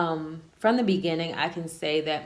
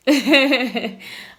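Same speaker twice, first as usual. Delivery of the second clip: tipsy giggle; 7 semitones higher, 8 dB louder than usual. Real, not acted. Laughs at own tipsiness.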